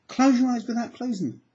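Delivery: high, pressing command; urgent command. Shown as a demonstration. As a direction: really push them now. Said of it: "Try really hard".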